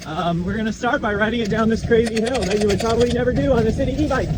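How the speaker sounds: talking over a rough ride; on a bumpy road. Real, not acted. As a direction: voice jolted by bumps. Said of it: "it's so bumpy".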